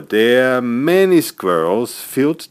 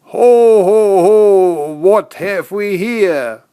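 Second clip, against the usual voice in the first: loud, high, slow jolly ho ho ho; noisy Santa exclamation; 10 semitones higher, 6 dB louder, slower than usual. Acted. Santa finding Joey asleep among empty boxes, loud and jolly. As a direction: boom it out like a delighted Santa. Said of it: "he exclaimed noisily".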